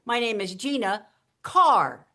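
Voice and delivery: GINA held, then CARR punched loud and long; musical punch. Shown as a demonstration. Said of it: "Punch it musically".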